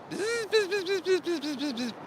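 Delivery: high, rapid imitated insect buzzing; cicada buzz. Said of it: "they make this sound like".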